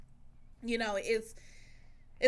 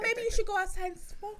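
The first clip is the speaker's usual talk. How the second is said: much higher, laughing; teasing suggestion; 10 semitones higher than usual. Retold or imitated. Telling partner to go smoke, high teasing. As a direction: tease him lightly. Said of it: "I'm like".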